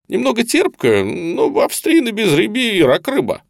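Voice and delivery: high-pitched, animated, hospitable patter; effusive interrogator colonel. A narrator's reading. Colonel urging cigarettes and wine on prisoner, effusively coaxing. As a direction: press hospitality on a wary guest, pitch swooping up.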